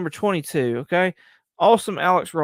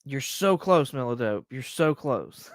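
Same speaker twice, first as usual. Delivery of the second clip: normal talking voice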